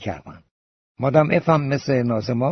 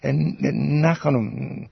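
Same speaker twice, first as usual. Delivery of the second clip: slow, hesitant stammered denial; flustered, under breath; 3 semitones higher, much slower than usual. A narrator's reading. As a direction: stall nervously while searching for an answer. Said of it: "هول شد، زیر لب گفت".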